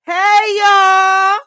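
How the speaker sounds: high, loud hey y'all; loud greeting. Acted. Host greeting listeners with a high, loud hey y'all. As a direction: shout it out to the crowd.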